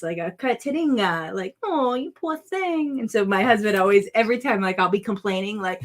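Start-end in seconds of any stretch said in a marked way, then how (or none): 1.6-3.0 mock pity. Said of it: "poor thing in Portuguese".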